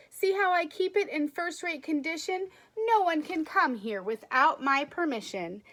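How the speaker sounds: lively storybook read-aloud; expressive picture-book narration; reading to children. Acted. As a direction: read it as if to a class of kids.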